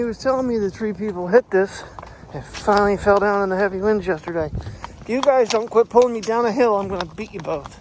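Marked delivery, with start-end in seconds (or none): 5.0-7.7 loud, exasperated warning to the dogs; loud scolding. Real, not acted. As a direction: call out sharply at the dogs.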